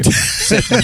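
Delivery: laughing high command. Real, not acted. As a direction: bark it through laughter.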